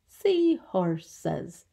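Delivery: "Seahorses" stretched into three distinct beats; syllable by syllable. Shown as a demonstration. Teacher counting syllables for children, "Seahorses" divided in three. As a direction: measure out each syllable of "Seahorses". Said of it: "Three syllables".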